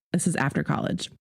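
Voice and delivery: ordinary delivery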